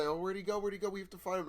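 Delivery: high, urgent pretend worry; mock concern. Imagined worried friend, acted in story.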